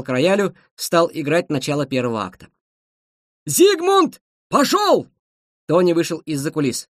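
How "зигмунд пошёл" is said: high, loud called-out cue; stage command. A narrator's reading. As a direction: call the actor on from the piano. Conductor shouts stage cue.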